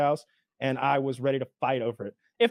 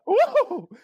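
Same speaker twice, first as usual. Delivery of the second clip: high, loud startled "woo"; startled whoop; 6 dB louder than usual. Retold or imitated. Waitress startled by a yelling diner, high mock whoop. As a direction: recoil with a high-pitched whoop.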